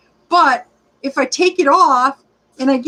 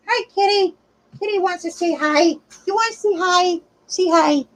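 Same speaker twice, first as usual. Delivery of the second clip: lightly raised voice for the cat; sweet pet voice, only a little higher; pet talk; 4 semitones higher than usual. Real, not acted. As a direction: coax the kitty gently. Talking to her cat.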